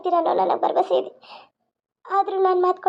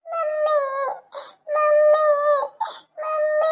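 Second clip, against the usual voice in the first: put-on small child wailing for mummy; higher tearful child's call; crying child; 9 semitones higher, much slower than usual. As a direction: cry out high for your mother. Child cries as mother leaves.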